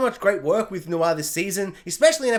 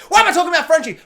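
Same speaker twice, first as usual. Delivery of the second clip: much higher and louder mock exasperation; loud exasperated self-aside; self-mocking exclamation; 10 semitones higher, 8 dB louder, much faster than usual. Real, not acted. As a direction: catch yourself going off track. Catching himself mid-rant.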